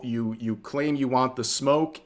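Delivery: usual speaking voice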